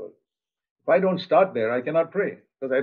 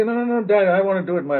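put-on child insisting; 6 semitones higher, 4 dB louder than usual. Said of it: "They say".

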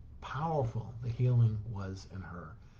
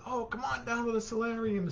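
bright, much higher cheerful call; imitating an enthusiastic patient; enthusiastic invitation; 12 semitones higher than usual. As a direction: invite him along with real enthusiasm. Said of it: "she was just as enthusiastic".

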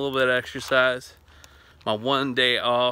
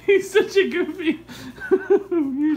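high voice breaking into laughter; amused laughing; 4 dB louder, slower than usual. Real, not acted. Owner tickled by goofy dog, laughing. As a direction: talk through giggles at a pet.

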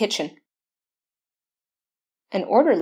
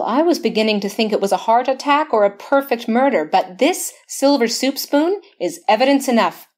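ordinary talk, nothing marked

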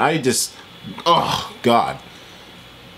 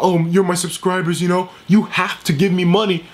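higher, put-on voice mocking a greedy creator; mock whiny YouTuber; 6 semitones higher than usual. Vlogger mocks the YouTuber begging subscribers for money. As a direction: sneer as you imitate him. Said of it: "Talking about".

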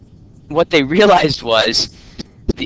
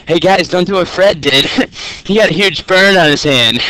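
raised, higher mock warning to the guys; mock warning call; 4 semitones higher, 4 dB louder than usual. Teacher voicing scientists warning colleagues, a raised mock call. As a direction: call out a jokey warning to the lab. Scientists warned after a burn.

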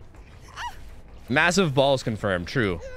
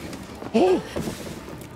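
mock gasp; 13 semitones higher, 4 dB quieter than usual. Mock shock before "just kidding.

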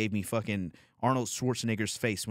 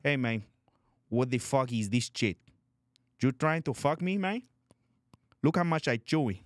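mock Colombian accent; 5 semitones higher than usual. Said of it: "like a fucking Colombian coke dealer".

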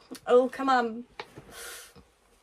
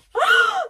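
a high, loud, tearful gasp; sharp gasp; 5 dB louder than usual. Real, not acted. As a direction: gasp as if about to cry. Overwhelmed by the fight scene.

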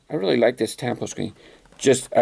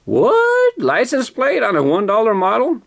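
surprised; 7 semitones higher than usual. Real, not acted. Surprised at license plate.

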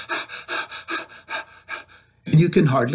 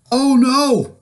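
sudden higher, louder gasp of alarm; 10 semitones higher, 5 dB louder than usual. Acted. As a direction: catch it as the tree bends. Tree about to topple.

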